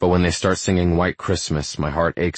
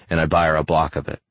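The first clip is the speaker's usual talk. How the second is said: usual speaking voice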